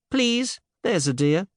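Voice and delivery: put-on high, wheedling young woman; coaxing plea. A narrator's reading. Cinderella coaxing him to agree.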